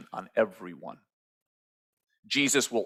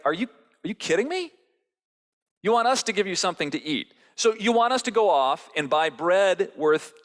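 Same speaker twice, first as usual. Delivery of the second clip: higher, fast, indignant questions; incredulous and fed up; frustrated disbelief; 6 semitones higher, 3 dB louder than usual. Acted. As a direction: disciples exasperated with Jesus. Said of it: "their frustration and their anger starts to bubble through".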